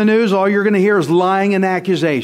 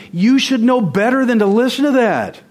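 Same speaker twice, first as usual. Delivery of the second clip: higher, louder pleading rebuke; emphatic rebuke; 8 semitones higher, 3 dB louder than usual. Real, not acted. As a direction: scold them for their own good. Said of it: "I want to say the same thing".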